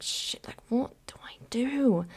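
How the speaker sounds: hushed, drawn-out whisper of dismay; panicked whisper. Retold or imitated. Student at a loss over the repair bill, whispering in panic. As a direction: whisper it, stretching out the question.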